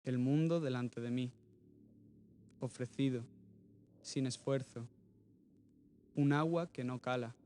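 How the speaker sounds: sparse verse in short phrases, long pauses between; measured, earnest poetry reading; poem recitation. Acted. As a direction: read each short line alone, then pause. Reads untitled poem aloud.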